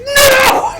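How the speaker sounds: a high, loud, exasperated "No!"; mock shout. Rocket yelling at Groot over the button, a loud mock shout. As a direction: yell it like a fed-up Rocket. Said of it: "He's like".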